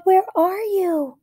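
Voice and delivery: high slow calling; searching call. A narrator's reading. Emma searching for lost pet, high slow call. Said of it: "she called".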